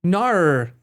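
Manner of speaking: ordinary talk, nothing marked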